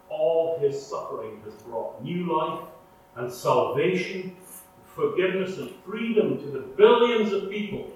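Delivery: usual speaking voice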